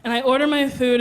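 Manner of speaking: ordinary delivery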